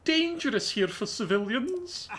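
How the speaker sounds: a scholar with a gentle Scottish lilt; slightly posh Scottish. Acted. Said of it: "slightly posh Scottish accent".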